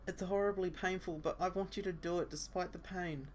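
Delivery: put-on pained girl voice. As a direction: play her hurting and scared. Asa in pain.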